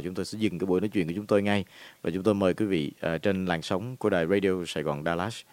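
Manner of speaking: ordinary talk, nothing marked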